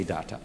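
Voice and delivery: "data" said DAH-tah, as in Latin; "data" the Roman way, DAH-tah; Latin pronunciation. Shown as a demonstration. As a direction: give "data" two open "ah" vowels. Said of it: "because it's Latin".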